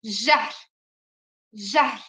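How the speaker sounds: Jehr, Kurdish j; model word. Shown as a demonstration. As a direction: say Jehr with j as in French. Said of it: "J harfine geçtik".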